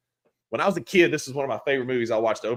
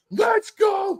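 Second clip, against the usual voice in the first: very high triumphant shout; mock hype yell; excited shout. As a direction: cheer it like your team just scored. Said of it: "I was like".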